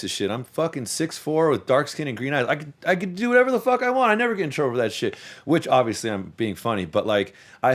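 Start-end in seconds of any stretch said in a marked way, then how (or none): 2.5-5.2 higher, fast cocky brag; mock cocky boast. Joking to friends about getting away with anything, mock cocky boast. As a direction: brag like you own the place.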